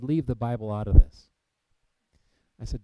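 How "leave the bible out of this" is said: loud, hard fall on leave; angry shout. Retold or imitated. Father shuts down his son's Bible talk, loud and angry. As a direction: bark it at your son like a furious father. Dad forbids son's Israel plans.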